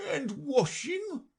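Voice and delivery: high, very slow, anxious turtle; anxious Mock Turtle. Mock Turtle asking Alice, anxious. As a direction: ask it with worried hope.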